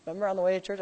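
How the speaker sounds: ordinary delivery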